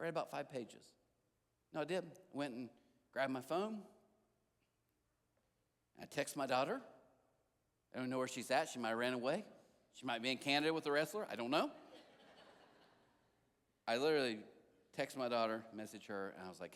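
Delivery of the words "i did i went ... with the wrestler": usual speaking voice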